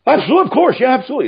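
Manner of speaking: eager agreement. Retold or imitated. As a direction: agree far too eagerly, relieved. Douglas eagerly agreeing to ride.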